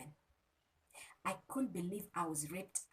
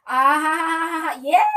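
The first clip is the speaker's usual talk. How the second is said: high, loud teasing gush over money; mock excited; 8 semitones higher, 20 dB louder than usual. Teasing viewers who want money talk, a loud mock gush. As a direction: hype it up to tease the viewers.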